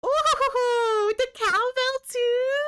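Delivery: high, laughing delight; thrilled at a new gift; delighted surprise. Real, not acted. Streamer delighted by a gift, laughing surprise.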